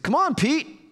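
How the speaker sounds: high-pitched mock pleading with a pal; playful coaxing. Taken aback friend tries Peter again, high coaxing. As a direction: coax a reluctant friend, voice jumping up.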